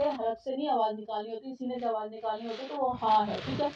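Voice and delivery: usual speaking voice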